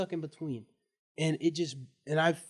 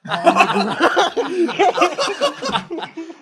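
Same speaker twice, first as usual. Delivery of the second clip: dragged out through laughter, much louder and higher; can't get it out for laughing; 14 semitones higher, 13 dB louder, much slower than usual. Real, not acted. Telling friends about the fake fall, cracking up.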